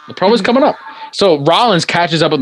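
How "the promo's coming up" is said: promo's coming up lifted high and sung; higher announcing. Shown as a demonstration. Teasing the podcast cohost that the promo is next, higher. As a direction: pitch it up like a teaser.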